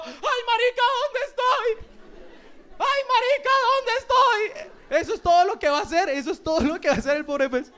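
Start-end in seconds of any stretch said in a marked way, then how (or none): 0.0-1.8 high-pitched panicky cartoon fish; confused goldfish. Goldfish in a bowl with no memory, high bewildered voice. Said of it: "un pez diciendo". 2.8-4.4 very high, bewildered fish voice; confused goldfish. Acted. Goldfish lost in its bowl once more, high bewildered voice. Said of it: "un pez diciendo".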